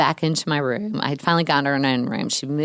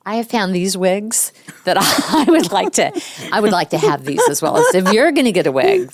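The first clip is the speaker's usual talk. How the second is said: put-on higher, louder child asking for wigs; eager little girl; 5 semitones higher, 6 dB louder than usual. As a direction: plead like an excited kid. Daughter quoted asking for wigs.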